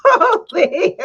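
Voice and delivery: high, loud giggly retelling; imitated giggling. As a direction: mimic her giggle, breaking into laughter. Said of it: "she was giggling when he said it".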